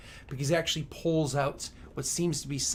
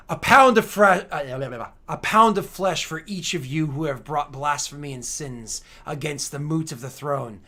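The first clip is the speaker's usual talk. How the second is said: raised, louder booming sentence; wrathful decree; 2 semitones higher, 10 dB louder than usual. Acted. Emperor rising from his throne to curse them, wrathful. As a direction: proclaim the punishment in fury.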